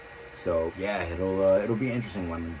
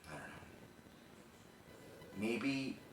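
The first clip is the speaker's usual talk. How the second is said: hushed, halting monologue with long pauses; subdued, heavy storytelling, pausing between phrases; dramatic confessional monologue; 10 dB quieter than usual. Acted. Performs the rehearsed monologue.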